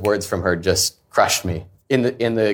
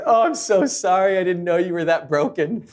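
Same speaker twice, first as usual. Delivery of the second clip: much higher, mock-tearful mom voice; mock crying mom; 8 semitones higher than usual. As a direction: play the sobbing mother, hammed up. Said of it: "my mom just starts crying".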